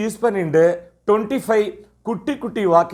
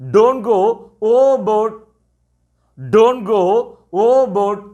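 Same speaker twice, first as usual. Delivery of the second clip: slow teacher model phrase; 3 semitones higher, 3 dB louder, much slower than usual. Shown as a demonstration. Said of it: "எப்படி சொல்லலாம்?".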